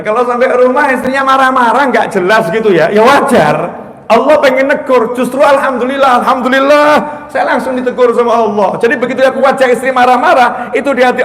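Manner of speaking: loud, high-pitched sermon warning; fiery preacher rebuking the congregation; forceful preaching. Acted. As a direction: preach it hard at the husbands.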